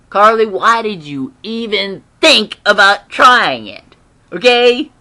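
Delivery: loud, incredulous telling-off; exasperated scolding. Acted. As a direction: scold Carly in disbelief, drawing it out. Scolding the character she recaps.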